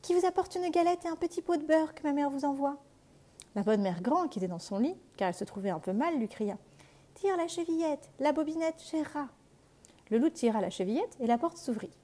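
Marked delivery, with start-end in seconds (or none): 0.0-2.8 high put-on girlish voice; wolf faking girl. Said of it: "en contrefaisant sa voix". 7.1-9.3 high frail granny voice; old grandmother. Acted. Grand-mère répond.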